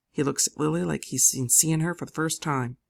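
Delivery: ordinary delivery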